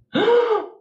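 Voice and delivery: loud high gasp of fright; sharp gasp. Real, not acted. Streamer startled mid-ritual, gasping. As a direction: gasp as if something moved.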